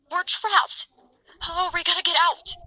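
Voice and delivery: high, loud panicked mother; frantic, high-pitched panicked yell; hysterical scream. A narrator's reading. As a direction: cry out in hysterics, voice jumping up. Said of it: "My mother screamed hysterically".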